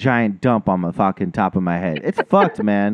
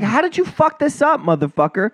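high-pitched mock outrage; exasperated rebuke; 8 semitones higher than usual. Customer retelling his anger at the barber, exasperated rebuke. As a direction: act out scolding him in exasperation, pitched up.

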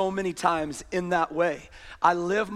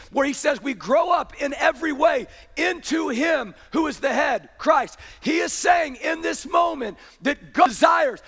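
full-force preaching; 5 semitones higher than usual. Acted. Pastor urging the congregation, preaching at full force. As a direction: drive the point home from the pulpit.

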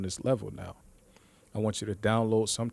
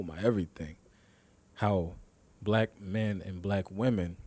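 normal talking voice

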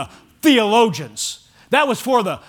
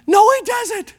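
high-pitched, emphatic rebuttal; incredulous, much higher-pitched denial; emphatic contradiction; 9 semitones higher, slower than usual. Real, not acted. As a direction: flatly refuse the claim, voice jumping high. Rebutting a mom defending her son.

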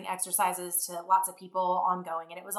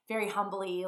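normal talking voice

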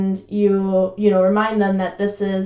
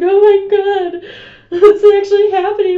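high, loud, tearful wail, played up for laughs; a hammed-up teen sobbing in panic; mock panicked crying; 12 semitones higher, 8 dB louder than usual. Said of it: "Because I was just like".

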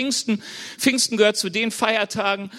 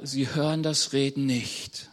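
ordinary talk

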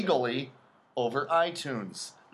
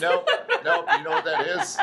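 laughing; 14 semitones higher, 4 dB louder than usual. Real, not acted. Laughing high reply.

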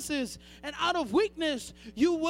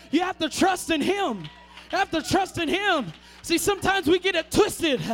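loud, high shouted preaching; preaching at full force; 5 semitones higher, 7 dB louder than usual. Acted. Preacher pounding the pulpit for the church, full-force shout. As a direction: shout the sermon home to the congregation.

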